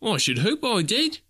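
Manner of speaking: high, cheeky reply; cheeky lad. A narrator's reading. The lad answering Scrooge, sure of himself. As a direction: answer as if it's obvious. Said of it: "replied the lad".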